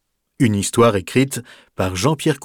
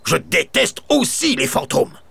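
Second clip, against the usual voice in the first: grumpy baron; 7 semitones higher than usual. A narrator's reading. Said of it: "réplique le baron".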